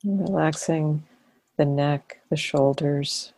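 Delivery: calm, unhurried body-scan voice with long pauses; guided meditation. Real, not acted. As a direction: ease them into relaxing, phrase by phrase. Leads a body-scan relaxation.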